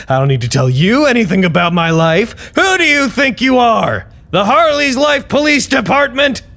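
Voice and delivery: rising, high, exasperated outrage; indignant rant. Acted. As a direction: rant at them in disbelief.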